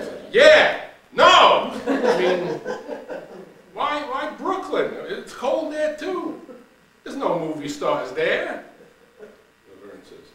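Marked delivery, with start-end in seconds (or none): 0.3-8.6 loud, much higher, laughing disbelief; startled protest. Acted. Shirley laughing off Laverne's Brooklyn plan, loud and high. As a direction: recoil at the idea of Brooklyn.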